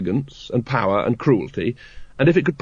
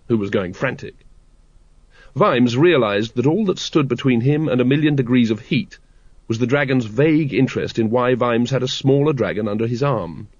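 plain reading voice

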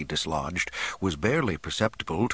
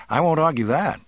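high, louder youthful reply; emphatic young man; 7 semitones higher, 5 dB louder than usual. A narrator's reading. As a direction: agree at once, with force. Youth readily agrees.

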